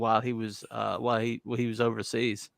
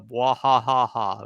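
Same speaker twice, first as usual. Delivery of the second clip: evil laugh; 4 dB louder, slower than usual. Acted. Spelt villain laugh.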